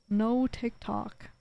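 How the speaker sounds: slow, dragged-out, mopey echo; sulky drawn-out repeat. Real, not acted. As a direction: drag each word out, sulking. Repeats phrase, second take drawn out.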